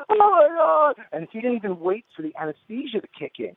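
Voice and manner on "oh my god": high, loud re-enacted wail; retold pained cry. Sweating patient holding his head, re-enacted anguished cry. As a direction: cry out as if in agony. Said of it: "and I started screaming".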